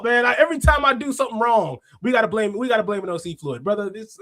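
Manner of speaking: ordinary talk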